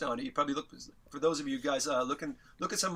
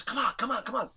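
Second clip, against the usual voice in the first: high-pitched mimicked beckoning; quick, insistent coaxing; impatient urging; 7 semitones higher than usual. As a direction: imitate a friend hurrying you out. Friend beckoning him out of elevator.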